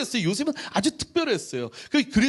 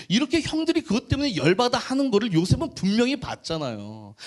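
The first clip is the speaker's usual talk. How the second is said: ordinary delivery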